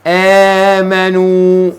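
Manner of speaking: drawn-out, measured verse reading; slow recitation. Shown as a demonstration. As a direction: stretch the final long vowels. Reads out Quran verse, word by word.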